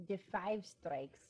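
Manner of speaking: slow, heavy mock Russian accent. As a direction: drawl it in a silly Russian accent. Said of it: "doing our stupid Russian accent".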